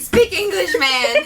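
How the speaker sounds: put-on loud, high grumpy old man; Scrooge. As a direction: bark it like a cranky old miser. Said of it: "Scrooge would be like".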